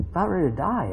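ordinary talk

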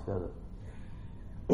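usual speaking voice

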